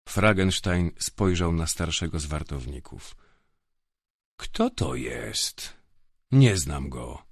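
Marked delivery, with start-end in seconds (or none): 4.4-7.3 slow, lower, cold question; menacing tone. A narrator's reading. Grifo asking older guard who the prisoner is, slow menace. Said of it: "W jego głosie wyczuwałem ton".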